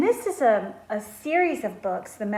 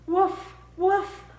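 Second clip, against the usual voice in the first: high, louder happy woofs; excited barking; 9 semitones higher, 3 dB louder, slower than usual. A narrator's reading. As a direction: bark like a thrilled puppy. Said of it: "said Sam".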